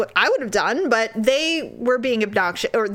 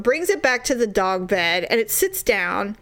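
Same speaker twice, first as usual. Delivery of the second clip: normal talking voice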